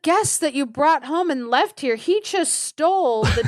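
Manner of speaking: theatrical aggrieved complaint; mock indignant accusation. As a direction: report a theft with hammed-up outrage. Wife's acted lying accusation.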